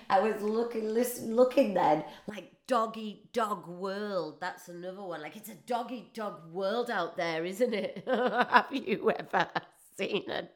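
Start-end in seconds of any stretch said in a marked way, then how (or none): none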